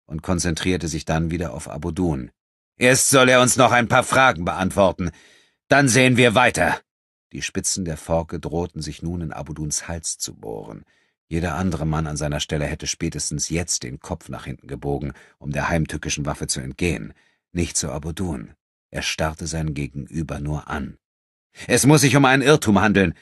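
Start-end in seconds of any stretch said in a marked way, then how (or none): 2.8-6.8 stern. Acted. Enrico decides to question first. 21.7-23.1 hasty. Acted. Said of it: "sagte André rasch".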